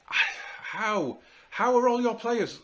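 disbelief. Real, not acted. Shocked at opponents' fresh players.

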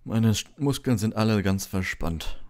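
Ron groaning. A narrator's reading. Ron groans to Harry about his aching arm. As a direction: sore and worn out. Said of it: "stöhnte er".

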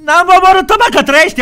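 high loud protest; desperate protest. Acted. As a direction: shout the denial in panic. Protests innocence under torture.

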